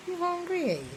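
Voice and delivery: high "You hungry, eh?" with a rising "eh"; rising "eh" question. Shown as a demonstration. Asking a child if he's hungry, high sing-song. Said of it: "you know".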